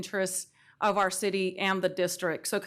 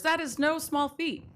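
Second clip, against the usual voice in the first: normal talking voice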